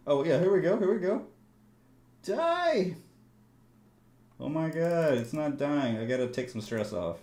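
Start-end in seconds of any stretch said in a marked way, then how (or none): 0.1-6.0 high and loud, thrilled; excited shout. Real, not acted. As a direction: cheer it on hard. Excited then frustrated.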